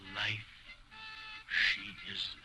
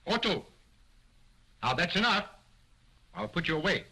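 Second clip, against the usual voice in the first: louder, higher stern scolding; 8 semitones higher, 6 dB louder, faster than usual. Acted. Ventriloquist scolding his cheeky dummy, loud and stern. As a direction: snap at him to behave.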